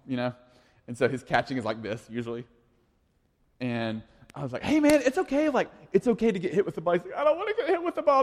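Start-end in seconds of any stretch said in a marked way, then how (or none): 4.6-6.9 reassuring dad. Retold or imitated. Dad reassuring son at baseball. 7.2-8.2 put-on high, fast, whiny kid voice with a laugh; hammed-up small boy refusing the ball; scared little kid. Son voiced in retold story.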